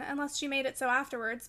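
ordinary delivery

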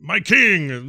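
loud, high Shakespearean proclamation; hammed-up theatrical address to royalty. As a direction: declaim it like a stage actor. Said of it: "he was way more, uh, Shakespearean".

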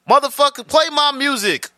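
high excited call; fired up, call for the music; hyped call out. Real, not acted. Calling for intro music.

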